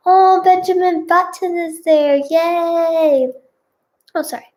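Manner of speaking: high, drawn-out delighted cheer; excited aside. Real, not acted. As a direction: gush and cheer. Reader delighted by cat mention.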